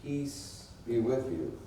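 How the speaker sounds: slow, soft, low greeting of peace; calm blessing. A narrator's reading. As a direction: speak peace gently, unhurried. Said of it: "Jesus came and stood among them and said".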